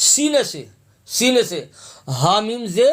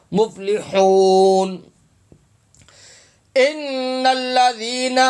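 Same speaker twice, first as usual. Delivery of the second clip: higher, slow chanted tajweed; measured Quranic chant; slow Quran recitation; 4 semitones higher, much slower than usual. Shown as a demonstration. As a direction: draw the verse out like a reciter.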